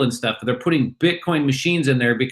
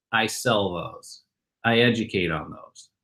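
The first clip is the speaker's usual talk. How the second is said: cool understated reply; 5 semitones lower, 7 dB quieter than usual. Retold or imitated. Guest answering the bar crowd, coolly understated. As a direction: play it cool and modest. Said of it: "they go, "Hey, what do you do?".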